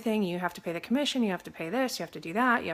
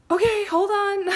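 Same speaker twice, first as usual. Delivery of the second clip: high, loud mock-frantic interjection; urgent pleading; 13 semitones higher, 7 dB louder, slower than usual. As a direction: jump in to halt a meltdown, hammed-up. Urgently stopping the crying wife.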